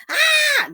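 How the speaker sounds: high, loud mock holler; mock scream. Retelling the scared man in the ad, mock scream. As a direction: let out a high shrieking holler.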